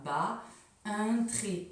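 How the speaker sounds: Ba, un trait, said slowly and deliberately; slow demo. Shown as a demonstration. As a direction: model the step slowly for kids. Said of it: "tu vas tracer un trait après chaque fin de première syllabe".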